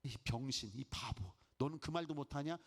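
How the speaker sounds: muttering low at yourself, a touch of disgust; lightly voiced self-blame; self-reproach. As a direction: scold yourself under your breath. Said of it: "자기가 자기한테 말합니다".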